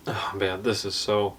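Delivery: low, soft groan of regret; regretful dread. Real, not acted. Guest eyeing too much sauce, regretful dread. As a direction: sink into quiet dismay.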